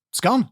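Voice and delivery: "gone" with a short "o", matching scone. Shown as a demonstration. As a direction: say gone with the same vowel as scone. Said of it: "sounds the same as it's gone".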